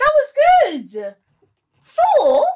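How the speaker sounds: loud, high disbelief; outraged. Real, not acted. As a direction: squeal it, offended. Indignant about the four rating.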